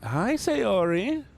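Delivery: high, slow drawn-out hello; sing-song greeting. Real, not acted. Streamer greeting a chatter, drawn out high. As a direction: sweetly sing out the name. Greeting chat member warmly.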